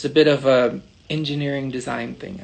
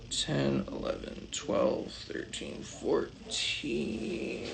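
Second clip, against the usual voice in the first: counting under breath; 4 semitones higher, 12 dB quieter, much slower than usual. Real, not acted. Counting finished pieces to herself.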